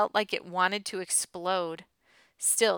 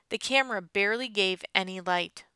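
ordinary delivery

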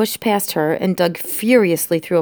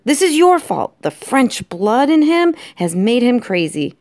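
raised, loud accusation; angry blaming; 6 semitones higher, 4 dB louder than usual. Acted. Lester blames his French wife, angrily. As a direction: blame in high-pitched outrage.

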